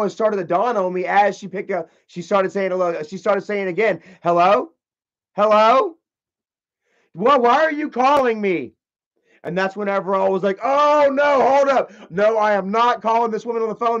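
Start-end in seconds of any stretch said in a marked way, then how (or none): 4.2-6.0 louder questioning hello; feigned phone hello. Retold or imitated. Woman fakes answering a call. 7.1-8.7 higher, indignant caller imitation; mock indignation. Woman pretends he phoned her. 10.6-14.0 louder, higher re-enacted objection; alarmed protest. Retells his protest at her pretence.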